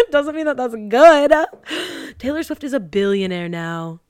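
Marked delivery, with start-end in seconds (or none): 0.0-1.3 laughing through the words, louder; real laughter breaking in. Real, not acted. Mocking the commenters while laughing loudly. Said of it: "And we're like". 1.4-2.1 a high breathy gasp; a gasp of sudden realization; sharp gasp. Real, not acted. Realizing [name] is a billionaire, sharp gasp.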